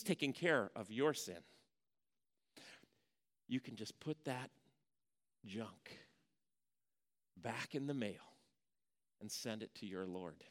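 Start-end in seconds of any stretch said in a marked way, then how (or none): 3.5-10.4 soft and low, gently sending it on; quiet reassurance. Acted. Pastor quietly reassuring the congregation to send their sin to the Lord. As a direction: hand it over calmly and quietly. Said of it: "pastor repeats the send-off quietly".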